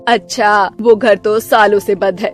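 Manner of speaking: low, bossy mother-in-law voice, stretched call; stern mother-in-law. Acted. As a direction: play the grumpy saas calling her daughter-in-law.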